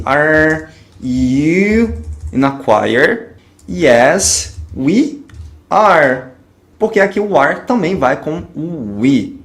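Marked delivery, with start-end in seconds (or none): 0.0-6.3 pergunta em inglês bem lenta e articulada; bem devagar, didático. Shown as a demonstration. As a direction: dite devagar como professor. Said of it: "vou colocar ele no início da frase". (pergunta lenta para o aluno repetir)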